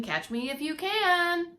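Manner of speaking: sing-song dare, drawn out; taunting baby, shouted. Acted. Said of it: "the gingerbread baby shouting".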